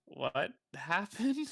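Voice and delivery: high, slow disbelieving question; drawn-out baffled ask; incredulous. Acted. Character asks about his own death.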